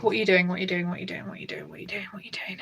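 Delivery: whispering to the cat, fading away; hushed coaxing of a pet; soft murmur to cat. Real, not acted. Talking to her cat Bowie.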